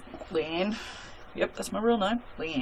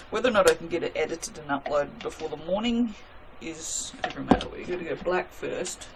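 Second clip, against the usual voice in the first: normal talking voice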